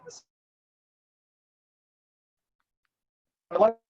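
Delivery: audio cutting out; broken-up call audio; corrupted glitchy audio. Real, not acted. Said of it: "your audio is cutting out".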